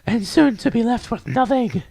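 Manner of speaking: put-on very high, girlish character voice; airy high falsetto character; high-voiced game girl.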